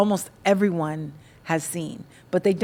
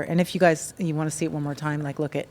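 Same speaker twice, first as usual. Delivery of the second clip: ordinary talk, nothing marked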